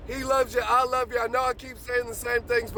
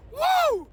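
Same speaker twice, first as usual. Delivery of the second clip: high, loud joyful whoop; ecstatic whoop; 13 semitones higher, 4 dB louder than usual. Real, not acted. Morning preacher whooping for his viewers, ecstatic whoop. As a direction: let out a wild happy whoop. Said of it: "Man, I'm excited".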